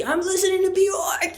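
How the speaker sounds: high, loud excited blurt; high-pitched, full-on retold outburst; oblivious shout. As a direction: call out as if over music in headphones. Oblivious shout over headphone music.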